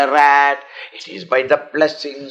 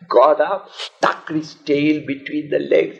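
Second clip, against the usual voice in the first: normal talking voice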